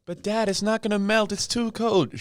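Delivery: put-on high-pitched kid complaining to dad; whining child. Kid protesting the frozen medicine to dad, whining.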